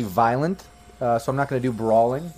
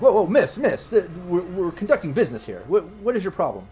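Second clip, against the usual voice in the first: higher-pitched, flustered objection; indignant doctor; 6 semitones higher than usual. Acted. Doctor confronts a woman barging into his room, indignant. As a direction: cut in to halt an intruder. Game master voices doctor stopping intruder.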